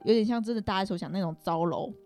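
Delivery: usual speaking voice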